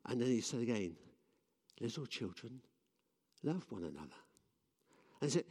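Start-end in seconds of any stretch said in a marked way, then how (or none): 1.8-4.2 softer, gentle and spent; soft, frail. Retold or imitated. Old John says it again, tired and soft. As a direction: let it sink almost to nothing.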